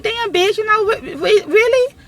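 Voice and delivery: high, whiny, fed-up really; can't believe this is happening; mock crying exasperation; exasperated disbelief. Stuck waiting on the constable.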